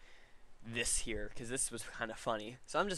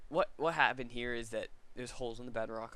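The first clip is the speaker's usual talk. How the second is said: ordinary talk, nothing marked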